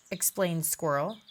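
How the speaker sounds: plain reading voice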